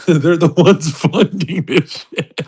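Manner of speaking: laughing through the words, slowed; words stretched out between laughs; giggling, slow. Real, not acted. Laughing through retold line.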